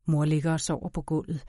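usual speaking voice

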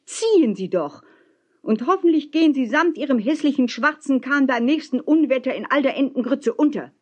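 high, defiant calling; gerufen. A narrator's reading. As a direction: hurl it up at the old man. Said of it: "rief ich". (trotzig und hoch hinaufrufen)

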